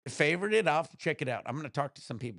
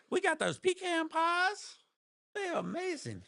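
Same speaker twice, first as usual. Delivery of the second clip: Southern accent. Shown as a demonstration. Showing chat his Southern accent, hammed up. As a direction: ham up a Georgia accent. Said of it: "do a good Georgia accent".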